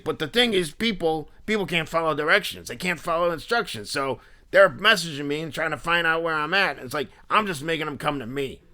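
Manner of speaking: high, emphatic in-your-face guy; intense Philly dude. Retold or imitated. Seller complaining about buyers, intense Philly dude. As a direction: rant as the intense Philly character. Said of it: "really in-your-face, intense character".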